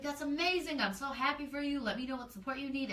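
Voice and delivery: high-pitched, gushing congratulations; retold delight, voice lifted high; excited support. Retold supportive reply to friend's news.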